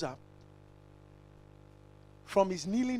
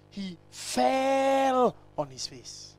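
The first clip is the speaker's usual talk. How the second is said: slow; 3 semitones higher, much slower than usual. Real, not acted. Preacher dwells on posture.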